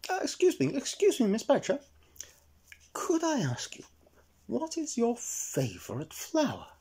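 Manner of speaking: timid high elf asking nicely; polite Eric. Acted. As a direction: ask sweetly like a shy small elf. Eric politely asks Miss Badger.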